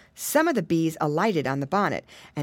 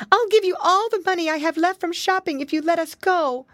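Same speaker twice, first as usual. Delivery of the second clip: high, urgent plea; pleading pig mother; 11 semitones higher, 4 dB louder than usual. A narrator's reading. Pig mother offers the wolf her money, pleading. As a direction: bargain desperately in a high voice. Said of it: "pleaded Missus Twistytail".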